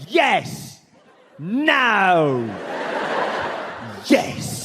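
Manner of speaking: a lightly voiced, curt [name]; terse one-word Jagger answers, barely changed voice; [name] impression. Comedian imitating [name]'s curt replies, lightly voiced. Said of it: "[name] talked like this".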